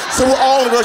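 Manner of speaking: ordinary talk, nothing marked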